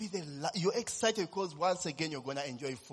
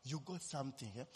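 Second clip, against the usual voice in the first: quiet, grave divine words; God's words retold gently and solemnly; 4 semitones lower, 9 dB quieter than usual. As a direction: lower and hushed for God speaking. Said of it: "And God said".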